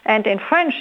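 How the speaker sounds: ordinary talk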